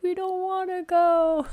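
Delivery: put-on high, slow, wailing child's voice; imitating her crying sons refusing to leave; crying kids. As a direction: whine it like an upset little boy. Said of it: "they're mad and they're crying".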